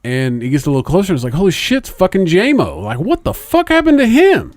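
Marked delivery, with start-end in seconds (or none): 1.3-4.5 rising to a high shocked yell; stunned disbelief. Retold or imitated. Retold shock at friend's growth.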